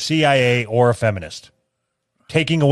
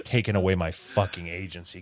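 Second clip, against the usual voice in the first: softer scornful mutter; muttered mockery; 8 semitones lower, 11 dB quieter than usual. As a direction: mutter it with contempt. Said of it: "sneering repeat".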